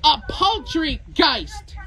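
drawn out very slowly for effect; slow deliberate punchline delivery. Acted. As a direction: stretch the punchline word by word. Punchline of a joke.

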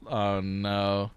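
normal talking voice

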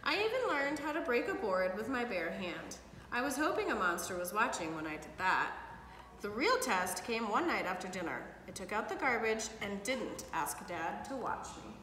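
put-on lively read-aloud for kids; storybook reading. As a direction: read it like bedtime story to children. Parent reads picture book aloud.

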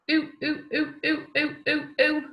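short clipped oo sounds, modelled. Shown as a demonstration. Teacher models short oo for children to copy. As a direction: say each oo short and crisp for the class. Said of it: "And again".

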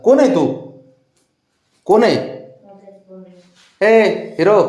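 high, loud playful "who goes there"; teasing, high-pitched calling out; mock challenge. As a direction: challenge a pet as if a stranger. Pretends not to know pet.

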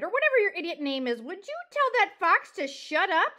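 loud, high and impatient; irritated cry. Acted. Porcupine wants the fox silenced, crying out. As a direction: complain at full volume. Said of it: "Aerith cried".

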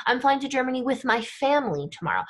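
"with my family" picked out, the rest quick; emphasize "family" with the voice; quick sentence leaning hard on "family"; stress on family. Shown as a demonstration. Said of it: "we would use our voice".

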